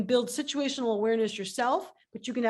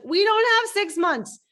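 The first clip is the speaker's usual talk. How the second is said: urgent outburst; 9 semitones higher, 7 dB louder, slower than usual. Retold or imitated. CEO urgent, retold.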